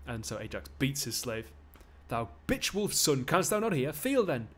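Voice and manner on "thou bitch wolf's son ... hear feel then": angry Geordie bruiser, high and loud; big strong Geordie losing his temper; Geordie accent. Acted. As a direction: bellow insults in Geordie. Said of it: "give him a Geordie accent".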